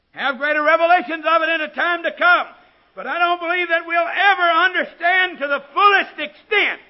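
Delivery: loud, high preacher's proclamation; ringing pulpit declaration at full volume; full-force preaching. Real, not acted.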